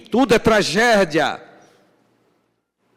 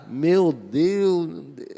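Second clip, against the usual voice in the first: dismayed sigh; much slower than usual. Real, not acted. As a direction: breathe it out, shaking your head. Preacher aghast at the complaint. (Deus meu, lamento baixinho que some)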